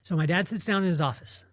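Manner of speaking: ordinary talk, nothing marked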